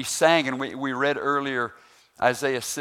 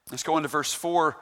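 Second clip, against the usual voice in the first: ordinary delivery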